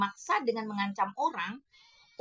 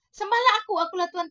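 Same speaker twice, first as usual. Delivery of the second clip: much higher and louder, a hammed-up command to worship; mock demanding god; 10 semitones higher, 8 dB louder, much faster than usual. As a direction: sneer out the fake god's demand. Mocking a false god's demand. (mengejek tuntutan Tuhan palsu dengan suara tinggi dan keras)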